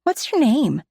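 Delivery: put-on high, bouncy little girl voice; eager little girl. A narrator's reading. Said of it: "she asks, bouncing on the balls of her feet".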